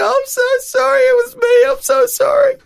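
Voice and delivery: high, wailing mock sob; sobbing apology. Man busted at the altar call, sobbing apology. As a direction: blubber the apology through tears. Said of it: "burst into tears".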